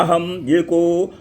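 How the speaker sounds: very slow, drawn-out Sanskrit intonation; slow sacred recitation. Retold or imitated. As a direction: intone it like scripture, word by word. Quotes goddess's Sanskrit words.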